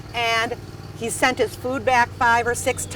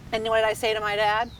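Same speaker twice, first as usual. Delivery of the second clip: ordinary talk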